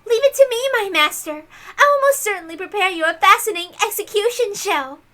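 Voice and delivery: put-on high, loud and gushing, a fawning young witch; bow and scrape to your master, loudly; high, loud eager servant voice; eager servant girl. Erica answers her master eagerly.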